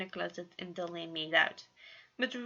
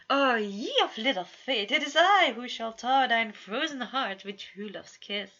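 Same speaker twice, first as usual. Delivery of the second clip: louder, theatrical fairy-tale prince; mock-gallant prince; 4 semitones higher, 7 dB louder than usual. A narrator's reading. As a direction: ham up the noble suitor. Roman playing gallant prince.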